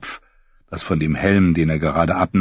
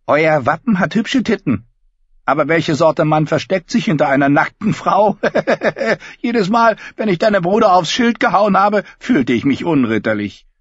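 high, loud bleating sheep voice; 11 semitones higher, 4 dB louder than usual. Acted. Davin voiced by narrator.

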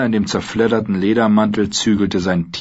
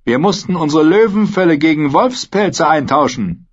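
higher, scornful mercenary voice; Dornish sellsword; 9 semitones higher, 4 dB louder than usual. A narrator's reading. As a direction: jeer at the captive knight.

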